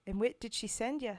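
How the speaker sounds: put-on loud, rough try at a Scottish accent; attempted Scottish accent. Streamer reading a poem to chat in an attempted Scottish accent. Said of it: "I have an attempt at an accent".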